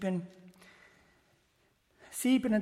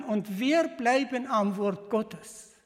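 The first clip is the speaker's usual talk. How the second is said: slow, firm declaration; 4 dB louder, slower than usual. Acted. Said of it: "dann kann man so schön sagen".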